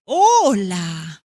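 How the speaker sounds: slow drawn-out greetings; leisurely hello and goodbye; greeting slowly. Shown as a demonstration. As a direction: stretch each greeting out. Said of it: "O decirle".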